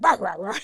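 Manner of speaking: loud garbled babble, imitated; mock flustered babble. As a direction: blurt out wordless mock babble. Mimics herself flustered at audition.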